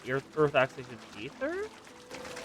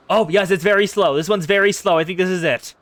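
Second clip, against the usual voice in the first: loud, raised-pitch burst of excitement; real excited outburst on spotting it; 3 semitones higher, 10 dB louder than usual. Real, not acted. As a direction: blurt it out, thrilled at the find. Finally spots the wanted crystal.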